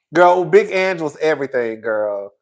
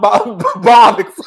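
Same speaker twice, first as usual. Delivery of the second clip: ordinary talk, nothing marked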